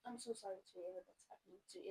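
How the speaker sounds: usual speaking voice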